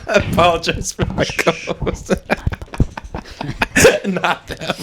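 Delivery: slow words squeezed out through laughter; cracking up while apologizing; laughing. Real, not acted. Co-host apologizing for his partner, laughing.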